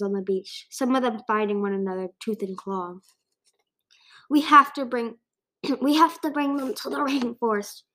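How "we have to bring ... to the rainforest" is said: coughing call. A narrator's reading. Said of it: "he called to Glory, coughing and gasping".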